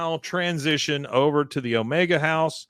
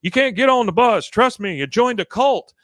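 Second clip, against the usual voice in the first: much higher, loud urgent yell; pleading shout; 10 semitones higher, 7 dB louder than usual. Retold or imitated. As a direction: shout at your wife to stop her boarding. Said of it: "He goes".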